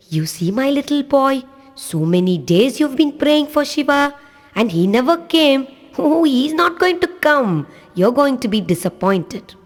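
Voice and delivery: a hint of a coaxing grown-up trickster; teasing god. Lord Shiva disguised, teasing the little boy, lightly coaxing.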